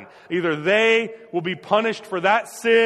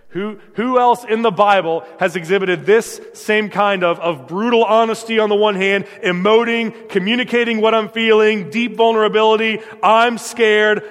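louder, rising, urgent sermon climb; preaching at full force; 3 semitones higher, 5 dB louder than usual. Acted. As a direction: drive it home to the congregation.

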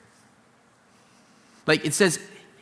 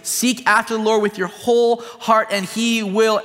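higher, louder, driving sermon climax; fervent pulpit preaching, raised and urgent; preaching at full force; 5 semitones higher, 3 dB louder than usual. Acted. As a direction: build it up like a revival preacher.

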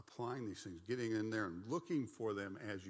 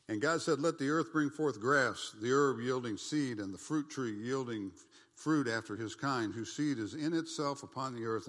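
scripture reading; 4 semitones higher, 9 dB louder than usual. Acted. Preacher reading Genesis to the congregation, loud and ringing. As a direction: proclaim the verses to the congregation.

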